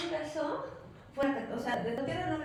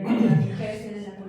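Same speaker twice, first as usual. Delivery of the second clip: mock sexist quip; 11 dB louder than usual.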